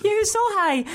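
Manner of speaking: very high cartoon kid voice; Baljeet. Showing kids his cartoon role, high character voice. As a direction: pitch it way up, cartoon-style. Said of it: "'cause Baljeet on Phineas and Ferb was".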